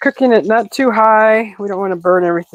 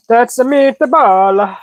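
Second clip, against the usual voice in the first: ordinary talk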